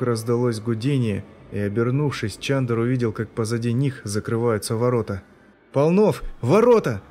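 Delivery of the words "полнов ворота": loud, high alarmed cry; shout. A narrator's reading. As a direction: yell the warning. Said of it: "закричал он".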